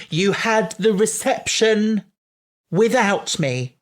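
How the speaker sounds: slow, high, indignant bride; Donna outraged. Retold or imitated. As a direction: wail it like a jilted bride. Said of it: "another favourite Donna moment".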